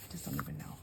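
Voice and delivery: breathy near-whisper, voice dropping away; trailing off. Real, not acted. Losing her thread while talking to viewers, fading whisper. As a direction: let it fade to a whisper. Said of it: "I don't even know why I'm saying this".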